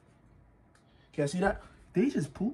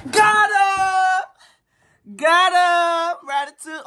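high, loud victory shout; much higher, louder got him; triumphant yell; 11 semitones higher, 4 dB louder, much slower than usual. Real, not acted. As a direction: shout in relief at the catch. Catching the rat.

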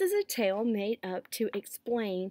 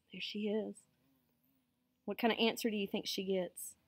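ordinary delivery, nothing marked

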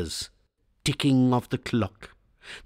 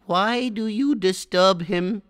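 put-on higher, slow old woman's plea; Granny pleading; 9 semitones higher than usual. A narrator's reading. Grandmother begging father not to wake the boy, slow and higher. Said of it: "Granny pleaded".

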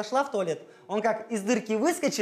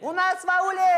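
shout; 7 semitones higher, 3 dB louder than usual. Acted. Call and response chant.

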